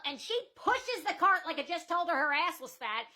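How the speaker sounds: ordinary talk, nothing marked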